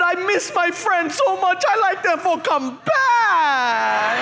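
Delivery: hammed-up sob, missing his friends; mock crying. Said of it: "He starts crying".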